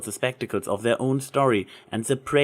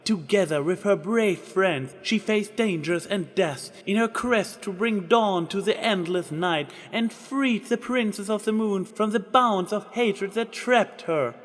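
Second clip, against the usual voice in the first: voiced Trixie proclaiming grandly; grand proclamation; 8 semitones higher than usual. A narrator's reading. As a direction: announce it like a stage magician. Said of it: "Trixie proclaimed".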